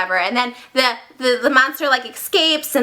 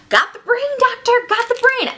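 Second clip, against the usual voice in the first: eager Igor; 11 semitones higher than usual. Acted. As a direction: call it out like a proud henchman. Acting Igor reporting to the doctor.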